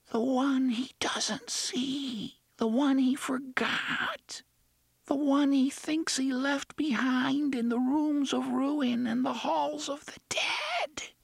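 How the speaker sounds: put-on high, very slow child whisper; a hidden frightened child whispering; eerie ghost child. A narrator's reading. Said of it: "the child's voice whispered".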